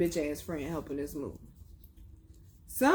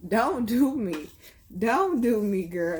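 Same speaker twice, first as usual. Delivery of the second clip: sassy; 3 semitones higher, 5 dB louder, slower than usual. Real, not acted. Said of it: "sassy mocking the neighbor".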